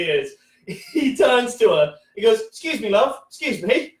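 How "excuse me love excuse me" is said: lightly acted, higher-pitched mate calling to a woman; buddy addressing a stranger. Comic playing his buddy turning to the girl, lightly acted blunt interruption.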